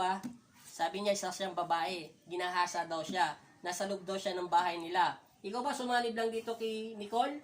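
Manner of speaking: normal talking voice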